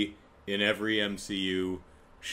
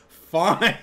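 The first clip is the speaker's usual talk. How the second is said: exasperated giving in; 10 semitones higher, 11 dB louder than usual. Retold or imitated. Wong giving in to Madison.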